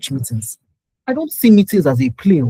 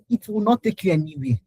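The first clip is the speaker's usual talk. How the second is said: ordinary delivery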